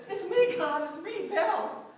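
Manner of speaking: loud, eager call to God; joyful call. Pretend. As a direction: call out to God, arms wide. Imagined running down aisle to God.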